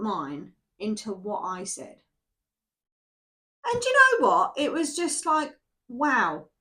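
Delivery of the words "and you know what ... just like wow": high and loud, a burst of delight; spring the surprise on the listener; excited. Retold or imitated. Tarot reader marvelling at her calm sons.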